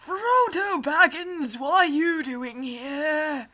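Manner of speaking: loud, slow wizard voice; hammed-up Gandalf. Gandalf greets Frodo in a loud slow hammy wizard voice. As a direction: declaim it like a surprised old wizard.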